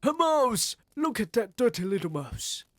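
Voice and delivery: high, loud alarmed yell of a cook; yelling man. A narrator's reading. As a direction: shriek it out as a cook spotting a mouse. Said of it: "I heard a man's voice yelling".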